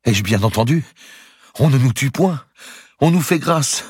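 high voice rising with incredulous relief; tearful disbelieving joy. A narrator's reading. Broken old Templar learns he is spared, tearful disbelieving joy. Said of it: "Il avait les yeux pleins de larmes".

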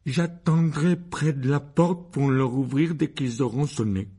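slow, put-on voice of a coached boy; dutiful Dudley reciting. A narrator's reading. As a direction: recite your assigned part slowly. (garçon qui récite lentement son rôle)

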